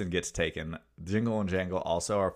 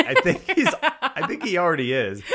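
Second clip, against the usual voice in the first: mock retort; 10 semitones higher, 8 dB louder than usual. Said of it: "And I'm like".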